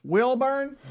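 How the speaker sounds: high-pitched waiting-room call; questioning, sing-out name call. Retold or imitated. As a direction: imitate a nurse summoning a patient. Nurse calling a name at the door.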